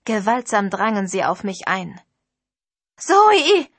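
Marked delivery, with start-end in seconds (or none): 3.0-3.7 much higher and louder, a terrified cry; frightened friend screaming a name; shrill scream of horror. Acted. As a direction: scream her name in panic. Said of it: "schrill vor Entsetzen rufen".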